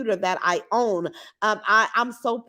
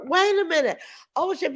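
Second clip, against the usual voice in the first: surprised, higher; 8 semitones higher than usual. Real, not acted. Reacting to a surprising comment.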